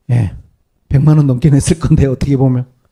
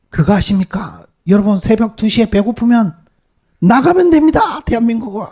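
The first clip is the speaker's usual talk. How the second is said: much higher, delighted showing-off voice; imitating an excited foreigner; 8 semitones higher than usual. Preacher quoting the American mother's video, high and excited. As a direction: play the thrilled foreign YouTuber. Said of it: "이러면서".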